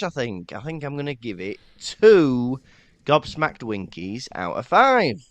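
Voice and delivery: usual speaking voice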